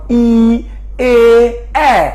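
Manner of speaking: ই, এ, এ held one at a time, ending on open "ae"; vowel demonstration. Shown as a demonstration. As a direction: sound out ই, এ, এ with stretched lips. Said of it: "তিনটি স্বরধ্বনি আমি উচ্চারণ করলাম".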